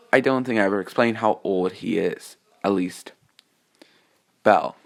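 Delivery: usual speaking voice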